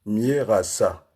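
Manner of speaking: slow, three separated syllables. Shown as a demonstration. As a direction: break the name into slow, distinct beats. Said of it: "trois syllabes".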